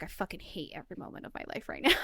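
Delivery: fast, whispered mock misery; whispered despair. Gamer whispering her frustration mid-game. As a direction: rush it out in a whisper, half joking. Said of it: "and I'm like".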